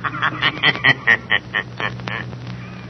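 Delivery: sinister laugh. Acted. The Shadow laughs on the phone.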